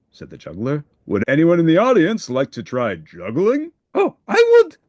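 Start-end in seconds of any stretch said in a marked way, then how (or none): 1.1-3.7 showman inviting the crowd; juggler's voice. Acted. Juggler addresses the audience. 3.9-4.8 very high, loud Oh I would; volunteer bursting with excitement; eager shout. Acted. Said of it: "cried Grover".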